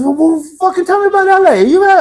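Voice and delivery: very high English mock demand; imitated English accent. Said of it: "I love your accent".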